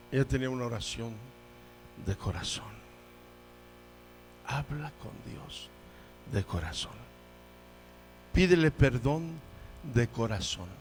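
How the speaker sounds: low, soft pastoral urging; solemn, intimate preaching murmur; hushed appeal. Real, not acted. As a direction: drop to a hush, pausing between phrases.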